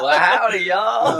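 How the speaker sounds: high laughing Southern cowboy drawl; a hammed-up Texas cowboy greeting; cowboy accent. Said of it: "accent, too?".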